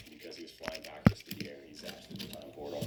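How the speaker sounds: ordinary delivery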